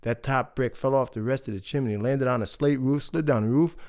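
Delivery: plain reading voice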